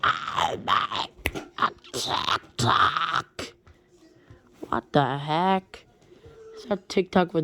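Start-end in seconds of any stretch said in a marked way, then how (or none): 0.0-3.5 low, rasping put-on cartoon voice; a low croaky Ren Hoek impression. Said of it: "he said something like this".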